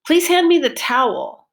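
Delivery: towel loud and high, vowel long and clear; focus on towel. Shown as a demonstration. Teacher modelling focus word towel for students. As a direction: lift and drop the pitch on towel. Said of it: "Pitch went up and down".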